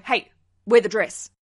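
loud, blunt mock order; bossy command. Bride telling her bridesmaids what to wear, loud bossy order. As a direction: play a bride laying down the law.

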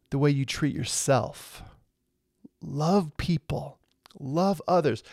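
soft plea. Real, not acted. Soft, slow exhortation to love.

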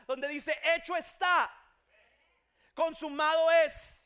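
loud, high, impassioned sermon; full-force preaching. Acted. Preacher declaring God's miracles, loud full-force preaching. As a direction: proclaim it to the whole congregation.

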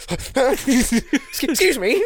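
high, drawn-out mock affront; put-on high indignant voice; offended woman. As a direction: act out an affronted "excuse me", high and stretched. Acts out outraged woman in sketch.

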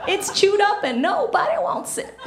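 mock sassy advice to a friend.

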